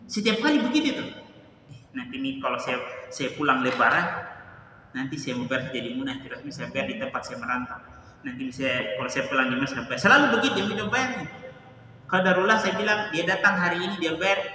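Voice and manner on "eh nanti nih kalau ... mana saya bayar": lower, softer, offhand voice of the man putting it off; imitating an excuse. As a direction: mumble the excuse like the procrastinating giver. Quotes the man's usual excuse. (menirukan dalih, lebih rendah dan pelan)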